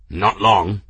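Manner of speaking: grim and firm. A narrator's reading. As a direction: give the bad news grimly. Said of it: "Kettle said grimly".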